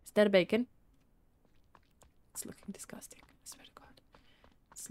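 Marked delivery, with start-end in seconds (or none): 2.3-4.9 disgusted whisper, voiceless. Real, not acted. Disgusted at bacon in game.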